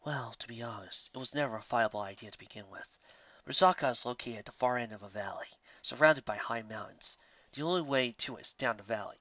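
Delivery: low, soft and measured; a deep-voiced stag lord keeping his voice down at the table; low hushed stag voice. A narrator's reading. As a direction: speak low and grave, leaning in. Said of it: "Whiteheart leaned forward".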